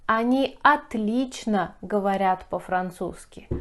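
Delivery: ordinary talk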